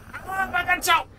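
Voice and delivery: startled in-game yelp; alarmed cry. Real, not acted. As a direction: yell out that it's grabbed you. Caught by monster in game.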